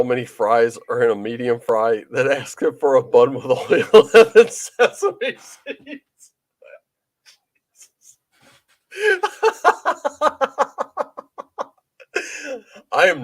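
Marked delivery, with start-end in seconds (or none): 3.9-13.2 laughing. Real, not acted. Laughing at his own joke.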